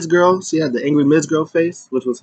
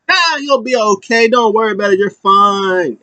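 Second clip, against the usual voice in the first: high, dismissive cheer; breezy mock reassurance; 7 semitones higher than usual. Manager telling hurt partner not to worry, breezy. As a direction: wave away the worry in a raised pitch. Chad brushing off injury, acted.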